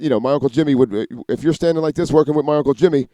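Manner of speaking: ordinary delivery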